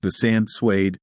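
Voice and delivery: plain reading voice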